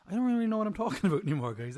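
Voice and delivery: fast, laughing through the words. Real, not acted. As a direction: giggle it out quickly. Laughing at own rambling.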